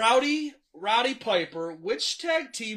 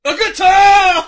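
loud, high, slow triumphant shout; excited shout; 9 semitones higher, 16 dB louder, slower than usual. Real, not acted. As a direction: announce it like a winner. Host reveals right answer.

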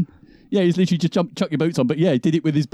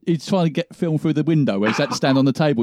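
ordinary talk, nothing marked

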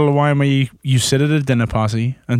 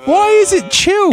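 much higher, incredulous question; appalled disbelief; 3 dB louder than usual. Real, not acted. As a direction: demand it in high-pitched dismay. Appalled that red wine is chilled.

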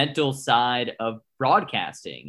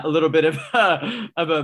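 louder, with a laugh breaking in; amused restart; 3 semitones higher, 4 dB louder than usual. Real, not acted. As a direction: pick it back up while laughing at yourself. Laughs at own voice crack.

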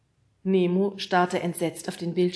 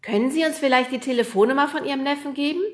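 fast, higher and louder call upward; hurried request; 8 semitones higher, 7 dB louder, faster than usual. A narrator's reading. Fred calling up to the roof, fast and eager. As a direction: rush the question up.

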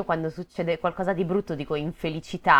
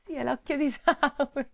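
mock ominous; 10 semitones higher than usual. Joking Sauron echo.

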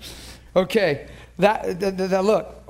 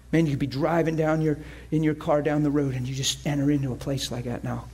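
normal talking voice

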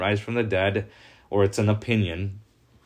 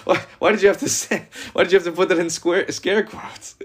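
much higher, fast, laughing wife's protest; hurt wife's reply; 8 semitones higher than usual. Retold or imitated. Wife objecting to her husband's scare-quoted I love you, high and laughing. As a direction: play the offended wife, high and quick through a laugh. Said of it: "like telling your wife".

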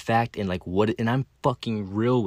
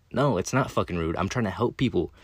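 usual speaking voice

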